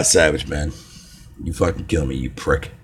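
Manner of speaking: normal talking voice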